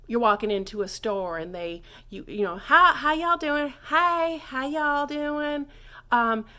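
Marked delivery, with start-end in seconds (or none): 2.6-5.6 loud, high, folksy hey y'all; casual Southern greeting. Shown as a demonstration. Teacher acting a casual store greeting, loud and high. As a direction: greet like a friendly Southern shopkeeper. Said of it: "If you're a little bit more formal".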